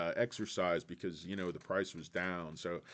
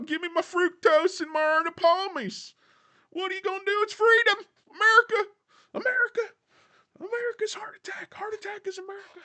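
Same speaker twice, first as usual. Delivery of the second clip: much higher, louder hammed-up voice of a greedy diner, climbing to a wailing cry at America; mock junk food lover; 13 semitones higher, 8 dB louder than usual. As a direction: parody a soda-guzzling patriot pleading for his sugar, way up high. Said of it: "Half of you fat bastards, like, protested".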